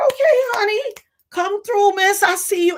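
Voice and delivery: very high excited praise; hype compliment. Retold or imitated. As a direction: gush over her look. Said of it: "I said".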